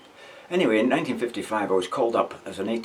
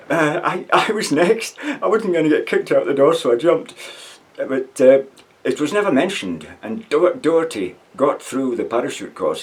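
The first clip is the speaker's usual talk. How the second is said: raised voice, higher and louder; excited; 6 semitones higher, 7 dB louder than usual. Real, not acted. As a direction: still laughing as he tells it. Excited telling after the laugh.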